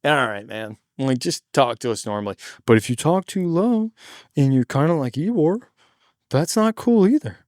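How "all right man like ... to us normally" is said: low, subdued complaint; unimpressed audience member. Retold or imitated. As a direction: mimic a weary crowd, pitched down. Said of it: "people are like".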